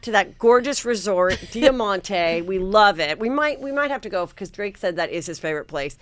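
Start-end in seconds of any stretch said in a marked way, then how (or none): none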